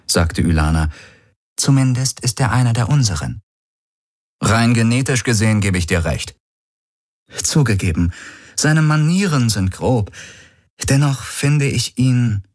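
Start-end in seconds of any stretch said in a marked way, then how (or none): 1.6-12.4 higher-pitched voice for a composed sister. A narrator's reading. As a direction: play the sister weighing her words. Narrator voices the sister Ylana.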